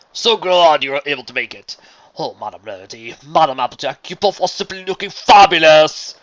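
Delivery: loud, theatrical flattery with stretched peaks; grandiose showman. A narrator's reading. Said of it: "came a grandiose voice".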